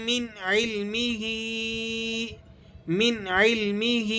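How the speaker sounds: من علمہ with a clean nun, no nasal hum; من علمہ without nasalizing; no ghunnah. Shown as a demonstration. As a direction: say من straight into علمہ. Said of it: "تو وہاں غنہ نہیں کیا جاتا".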